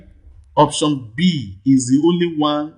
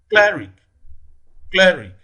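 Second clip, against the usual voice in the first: Cleric with CLE hit higher and louder; stress on first syllable; 4 semitones higher, 3 dB louder than usual. Shown as a demonstration. Teacher demonstrates where stress falls in Cleric, first-syllable stress. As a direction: punch the first syllable of Cleric, let the second fall. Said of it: "primary stress falls on the first syllable".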